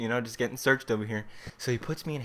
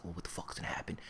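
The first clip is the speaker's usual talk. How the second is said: hushed whisper; 3 semitones lower, 12 dB quieter, faster than usual. Retold or imitated. Classmates staring, hushed quote.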